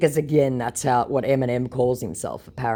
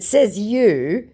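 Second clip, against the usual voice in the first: mocking retort; 8 semitones higher, 5 dB louder than usual. Her retort.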